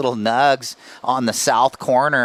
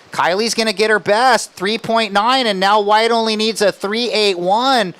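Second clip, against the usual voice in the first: excited commentary; 6 semitones higher, 3 dB louder than usual. Real, not acted. Heat closing, excited call.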